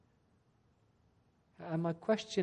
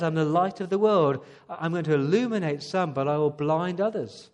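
louder, ringing proclamation; proclaiming; 2 semitones higher, 7 dB louder than usual. Retold or imitated. Preacher proclaims Jesus' words to the congregation, ringing. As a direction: declare it with full force.

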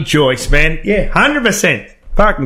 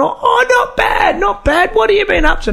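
put-on old auntie voice. Retelling Auntie Joyce answering the prank call, high shrill-free lady voice. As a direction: imitate the auntie squawking down the phone.

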